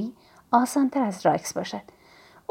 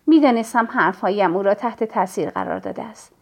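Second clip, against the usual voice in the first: plain reading voice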